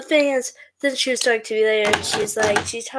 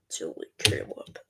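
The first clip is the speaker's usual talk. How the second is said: very low, soft silly tease; 9 dB quieter than usual. Pretend. Streamer teasing Caleb, low silly voice. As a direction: drop it right down, quiet and croaky.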